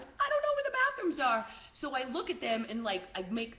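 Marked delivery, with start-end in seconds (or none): 0.0-1.5 loud, high-pitched fake confusion. Tourist still pretending for security guards, very loud. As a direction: call it out high, playing lost. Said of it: "I just start saying very loudly in English".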